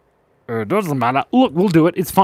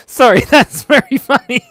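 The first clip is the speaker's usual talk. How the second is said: laughing; 10 semitones higher, 9 dB louder than usual. Real, not acted. Laughs at chat joke.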